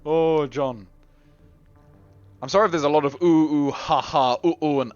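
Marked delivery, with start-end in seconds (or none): none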